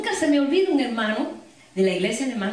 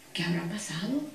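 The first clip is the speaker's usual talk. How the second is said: whisper; 5 semitones lower, 8 dB quieter than usual. Retold or imitated. Worker wondering why stones stopped.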